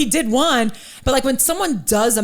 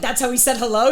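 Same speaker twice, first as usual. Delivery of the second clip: normal talking voice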